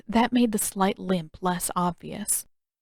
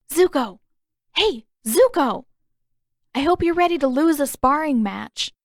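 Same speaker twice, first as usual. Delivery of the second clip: loud, higher call down into a pit; 6 semitones higher, 5 dB louder than usual. Acted. Katara calling Zuko out of his tent, loud. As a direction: shout his name to rouse him, teasing.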